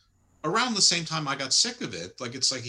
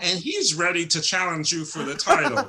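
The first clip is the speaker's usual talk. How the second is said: much higher, theatrical villain voice; hammed-up cult leader, pitched up; Evil Uno voice; 6 semitones higher, 4 dB louder than usual. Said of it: "Evil Uno goes".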